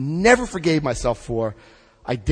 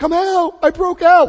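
excited yell; 10 dB louder than usual. Retold or imitated. Calling mother from jail.

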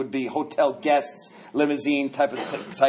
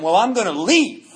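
a mildly raised, half-laughing threat to leave; first defiant threat to the concierge, mildly raised; 4 semitones higher, 4 dB louder than usual. Retold or imitated. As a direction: push back a little louder, daring him. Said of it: "you just raise your voice".

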